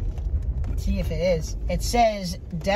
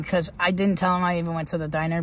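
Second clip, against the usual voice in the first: ordinary talk, nothing marked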